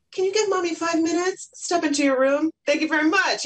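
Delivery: high, sing-song parent voice; role-played polite mommy. Mom replaying how she wishes she'd reacted, polite role-play.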